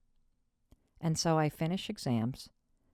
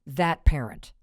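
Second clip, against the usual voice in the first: normal talking voice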